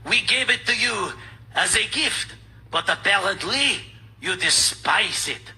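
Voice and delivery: slow, hammed-up French accent; movie French accent. Host mimics Glucksmann's demand, slow mock French accent. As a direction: stretch it out in cartoon French accent. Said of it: "I just do the accent like in movies".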